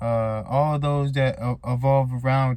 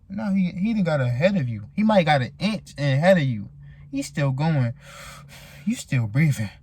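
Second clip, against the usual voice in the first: usual speaking voice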